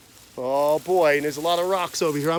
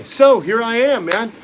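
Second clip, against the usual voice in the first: usual speaking voice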